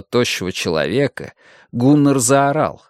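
ordinary delivery

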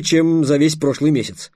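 plain reading voice

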